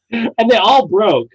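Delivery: usual speaking voice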